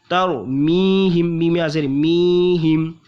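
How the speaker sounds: मीहिम with a nasal hum on मीम; slow ghunna on तरमीहिम. Shown as a demonstration. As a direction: hold the मीम in the nose. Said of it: "मीम में गुन्ना करना".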